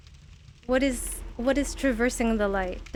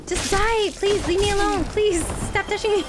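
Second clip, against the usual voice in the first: high, pleading whine; exasperated pleading; 6 semitones higher than usual. Real, not acted. Gamer begging enemies to leave them alone, exasperated pleading. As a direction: beg them in rising frustration.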